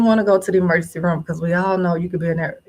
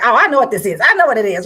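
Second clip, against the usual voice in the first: higher, loud, rushed retelling of an eager nurse; rapid excited nurse; 4 semitones higher, 8 dB louder, faster than usual. Retold or imitated. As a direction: rattle it off like a nurse who already knows. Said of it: "She's talking really fast".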